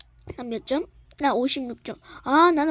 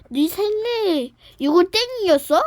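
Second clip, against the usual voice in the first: ordinary delivery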